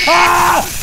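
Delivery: loud, high shriek; real jumpscare scream; terrified scream. Real, not acted.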